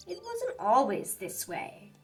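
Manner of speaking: ordinary delivery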